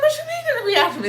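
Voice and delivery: high, loud, fast alarmed question; shrill squeak of alarm, rushing; panicked question. Retold or imitated. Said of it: "And I'm like".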